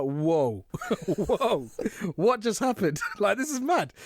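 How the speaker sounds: high, laughing disbelief as the drink suddenly hits; stunned whoa. Retold or imitated. Narrator recalling the shot hitting him, high laughing shock.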